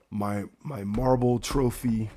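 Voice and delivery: ordinary delivery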